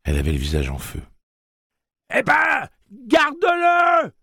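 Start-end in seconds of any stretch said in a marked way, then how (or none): 2.2-4.1 high, loud, slow furious yell; shouting. A narrator's reading. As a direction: scream it at him, drawn out in rage. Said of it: "hurla-t-elle".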